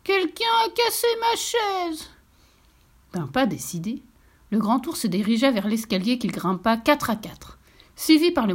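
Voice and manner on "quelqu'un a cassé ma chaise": high, slow, tearful cub voice; baby bear pleurnichant, voix aiguë; whimpering little bear. A narrator's reading. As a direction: whine it like a heartbroken baby bear.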